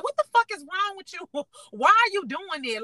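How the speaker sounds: high, disbelieving what the fuck; baffled outrage at him; retold disbelief at his gossip; incredulous. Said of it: "looking at him like".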